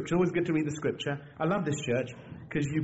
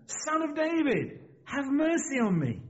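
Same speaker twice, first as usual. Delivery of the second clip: much higher, drawn-out plea; crying out more insistently; 10 semitones higher, slower than usual. A narrator's reading. Blind beggar persisting despite warnings, high insistent cry. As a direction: call out slowly and urgently, voice raised in pitch. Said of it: "but he cried out the more".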